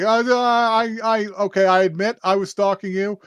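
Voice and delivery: high, stammered mock panic; freaking out. Caught follower confesses to the girl, freaking out. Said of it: "yeah, she freaks out".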